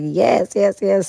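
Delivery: overjoyed, can't say yes fast enough; eager yeses. Retold or imitated. Accepting Prince's invitation eagerly.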